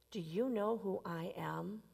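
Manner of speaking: soft whispered question. Acted. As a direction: whisper it as if a secret. Preacher repeats the punchline softly.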